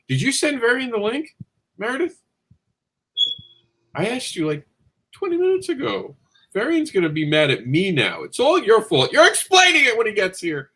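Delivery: exasperated scolding, voice climbing high. Real, not acted. Scolding co-host for missing link.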